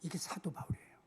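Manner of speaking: 이게 사도 바울이에요 whispered, no voice. Acted. Preacher introducing Paul to the congregation, whispered. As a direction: drop to a hushed whisper.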